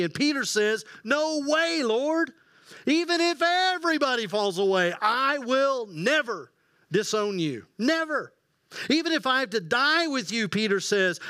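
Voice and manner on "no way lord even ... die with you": high, insistent promise; emphatic vow. Acted. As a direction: swear loyalty with all your heart. Peter boasting loyalty to Jesus.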